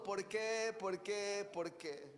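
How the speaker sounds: fading, plaintive por qué from a weeping person; a hint of a crying believer asking why; mock tearful lament. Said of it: "vas a estar ahí llorando".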